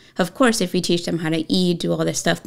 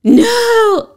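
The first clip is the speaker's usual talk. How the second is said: high, loud defiant yell; daughter's tantrum yell, far higher and louder; child's shout; 8 dB louder than usual. Retold or imitated. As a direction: scream a defiant no like a kid. Daughter refuses to go to her room.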